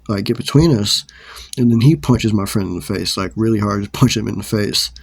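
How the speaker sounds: ordinary talk, nothing marked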